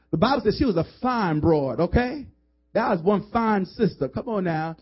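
normal talking voice

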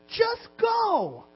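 high, drawn-out urging; urgent exhortation. Real, not acted. Preacher challenging congregation to move, high urgent exhortation. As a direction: push it way up, urging them out.

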